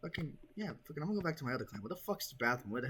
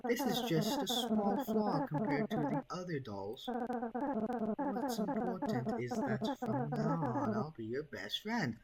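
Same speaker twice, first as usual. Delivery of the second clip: creepy doll voice; 10 semitones higher, slower than usual. Acted. Doll character speaks.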